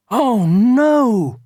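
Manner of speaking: high, loud villagers' "oh no"; dismayed cry. A narrator's reading. Villagers groaning about the cross giant, dismayed.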